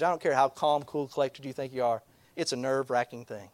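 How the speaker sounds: ordinary talk, nothing marked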